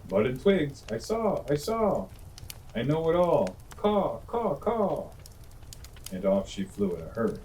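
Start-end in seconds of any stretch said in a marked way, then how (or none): 0.1-5.1 a smug blackbird cawing it knows it all. A narrator's reading. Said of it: "cried the Blackbird".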